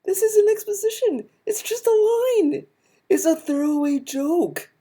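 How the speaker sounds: very high, indignant protest; exasperated outburst. Real, not acted. Rebutting a video's complaint about a joke line, exasperated. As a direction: argue back in a strained high pitch.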